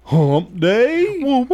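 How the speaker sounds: high, rising woo woo call; hump day cheer. Shown as a demonstration. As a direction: call it out like a radio drop. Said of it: "Do it again".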